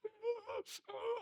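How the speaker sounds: very high wordless mock blubbering; hammed-up panicked gasping and wailing; imitated panicky wail. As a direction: imitate someone too upset to blow. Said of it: "And they're like".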